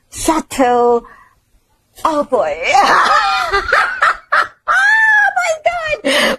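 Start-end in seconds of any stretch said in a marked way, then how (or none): 2.0-5.3 high laughing shriek. Real, not acted. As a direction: burst into a squealing laugh. Host blanks on the place name.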